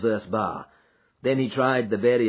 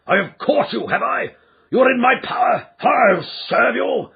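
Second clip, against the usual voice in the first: high, loud furious ranting; enraged man threatening the intruders; raging shout; 13 semitones higher, 7 dB louder than usual. A narrator's reading. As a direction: spit out threats, slowing to menace. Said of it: "he shrieked".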